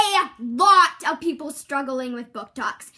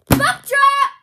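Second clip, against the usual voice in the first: loud, higher yell of the tagline; shouted catchphrase; 10 semitones higher, 8 dB louder than usual. Acted. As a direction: punch it out like a slogan. Commercial catchphrase yelled out.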